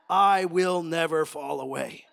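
slow, loud solemn vow; slow, emphatic declaration of loyalty; bold vow. Acted. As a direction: pledge it boldly, word by word. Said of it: "Peter's pretty bold".

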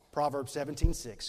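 normal talking voice

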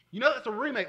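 loud, high mock outburst; loud insistent retort. Someone correcting the preacher about his favourite song, loud and insistent. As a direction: throw it back loudly, as an imitated know-it-all. Repeats critic's remark, much louder.

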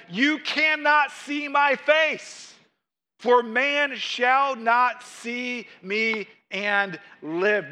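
high, slow, drawn-out decree; grave divine pronouncement. Acted. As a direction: proclaim it word by word.

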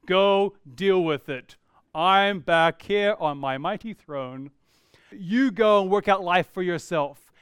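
slow, mock-lordly pronouncement; aloof pastor. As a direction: hand it down slowly, like a pastor from a mighty throne. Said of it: "very disingenuous of, of me as your pastor to say".

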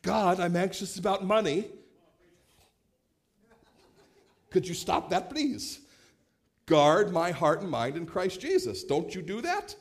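his younger self wanting quick fixes from God; mock prayer-genie plea. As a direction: beg God like a genie, hammed up. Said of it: "I'd be like".

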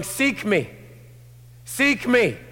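high, loud, insistent call; God calling. Acted. God waking the woman up, calling out. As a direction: call her to seek you. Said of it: "God's waking her up, going".

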